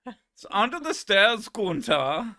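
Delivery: bad German accent. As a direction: belt the line again in the silly German voice. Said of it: "His German accent is terrible".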